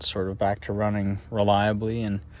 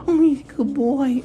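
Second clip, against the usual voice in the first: high squeaky dog praise; 7 dB louder than usual. Real, not acted. Praising the dog, higher take.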